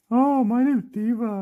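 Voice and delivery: loud, high toy-bear voice; Diva. Pretend. Toy bear repeats her name in reply, high funny voice. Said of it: "they'll be like".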